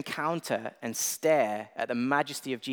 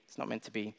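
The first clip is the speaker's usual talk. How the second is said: softer, sighing resigned repeat; fading resignation; 4 semitones lower, 12 dB quieter, faster than usual. Retold or imitated. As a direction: let it trail off in defeat. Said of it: "I'm like".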